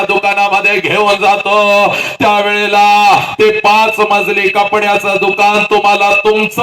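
high, sustained oratorical cry; impassioned oration. Acted. Speaker rousing students about their fathers, high impassioned cry. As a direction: belt it out in long rising-pitch phrases.

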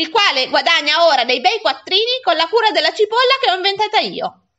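put-on high, heated boy snapping back; indignant outburst. A narrator's reading. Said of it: "e scattai".

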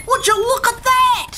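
high astonished voice; amazed exclamation. A narrator's reading. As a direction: exclaim at the sight. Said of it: "she exclaimed".

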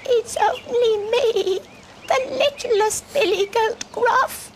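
very high, slow little-goat squeak; tiny goat voice. Acted. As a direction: speak as the littlest, smallest goat. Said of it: "tiny voice".

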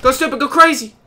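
loud, high hype yell; high, excited cheer; hyped-up shout. Real, not acted. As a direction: holler it like a hype man. Hyping up during the game.

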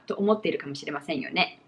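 usual speaking voice

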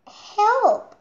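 high, loud frightened squeal for help; scared cry. Acted. Little chick squealing help in fright.

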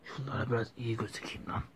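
whispered sneer at the fools; sly whispered gloat. Acted. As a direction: hiss it to yourself in triumph. Villain plotting alone.

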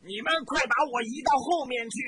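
loud, high frantic order; shouting. A narrator's reading. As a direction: bark at soldiers to move him. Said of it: "冲身旁的士兵大喊".

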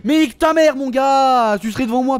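high, loud burst of swearing; real gamer rage; furious shouting. Real, not acted. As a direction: explode at someone who wronged you. Cursing game developers in rage.

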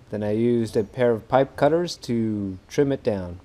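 normal talking voice

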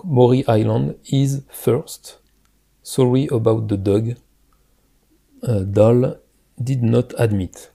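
slow, careful English with a heavy French accent; a French speaker carefully reading English. Real, not acted. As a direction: read the English out slowly in a thick French accent. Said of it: "excusez mon, mon accent".

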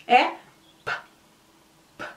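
isolated P with no vowel after; just the burst of P, no vowel; pure P sound. Shown as a demonstration. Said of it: "Esse é o som do P". (som puro do P, sem vogal)

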